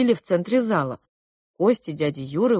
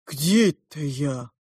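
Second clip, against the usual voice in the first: slow, bewildered, lowered voice; dazed awakening; 4 semitones lower, slower than usual. A narrator's reading. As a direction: ask it groggily, as if just come round. Dazed man wakes, looks around.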